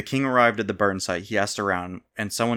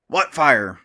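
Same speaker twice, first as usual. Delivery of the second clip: sharp, loud, higher demand; 5 semitones higher, 8 dB louder than usual. A narrator's reading. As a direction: snap the question out like an order. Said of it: "the king demanded".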